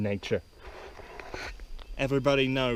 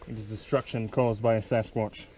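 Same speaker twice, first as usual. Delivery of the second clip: ordinary talk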